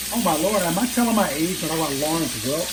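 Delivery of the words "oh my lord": ordinary talk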